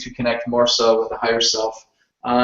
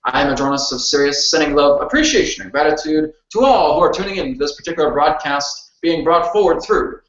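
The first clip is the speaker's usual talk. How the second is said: formal, higher-pitched spirit-guide voice; channeled entity; 4 semitones higher than usual. Acted. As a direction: speak as a solemn cosmic being. Channels Adronis in standing channeling.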